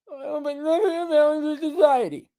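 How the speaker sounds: high, whiny, mangled echo; mock viewer's reply. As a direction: whine it back like a reluctant pupil. Mimicking viewer repeating it.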